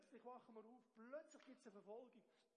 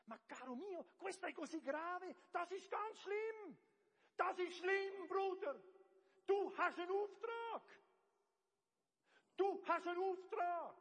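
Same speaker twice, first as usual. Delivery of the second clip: loud, high, forceful rebuke; stern rebuke; 7 semitones higher, 16 dB louder than usual. Retold or imitated. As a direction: confront him head-on, full voice. Preacher confronts businessman, retold. (lauter, hoher Tadel)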